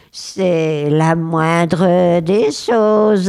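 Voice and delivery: very slow, drawn-out smug boast; full of vanity. Acted. The vain spirit boasts to the boy, very slow and smug. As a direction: savour each word, puffed up with pride. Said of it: "Plein de vanité".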